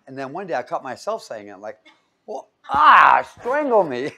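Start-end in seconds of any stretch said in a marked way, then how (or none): 2.3-4.1 loud, high-pitched comic outburst; mock horror. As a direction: yell it in hammed-up dismay, breaking into a laugh. Mock horror at catching himself.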